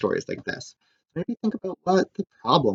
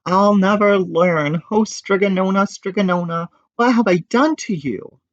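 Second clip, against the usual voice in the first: despairing; 8 semitones higher than usual. A narrator's reading. Despairing lament.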